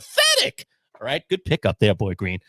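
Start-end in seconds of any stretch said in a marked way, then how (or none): 0.1-0.7 high, gloating taunt at a rival; mock taunt. Gloating at a league rival over his kicker. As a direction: rub it in, sing-song and smug. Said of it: "I am just waiting for Friday to say".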